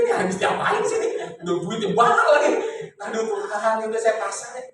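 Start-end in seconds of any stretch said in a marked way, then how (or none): 0.0-2.5 exasperated outburst. Retold or imitated. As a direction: burst out in disbelief at the money spent. Exasperated inner thought, retold.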